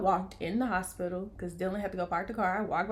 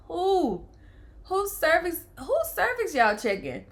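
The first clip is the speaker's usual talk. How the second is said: high, loud disbelieving cry of who; like she can't believe it's her body; stunned disbelief; 9 semitones higher, 5 dB louder, slower than usual. Retold or imitated. Mother tells her viewers about hearing the nurse, higher and louder in disbelief. Stunned at five centimeters news.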